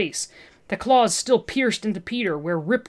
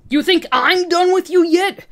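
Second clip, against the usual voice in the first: loud high snarl; 11 semitones higher, 8 dB louder than usual. Acted. Peter three challenging Olivia, loud snarl. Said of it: "Peter three snarled".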